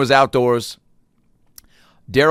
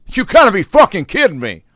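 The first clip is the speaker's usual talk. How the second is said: angry outburst; 10 semitones higher, 9 dB louder than usual. Retold or imitated. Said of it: "he was pissed".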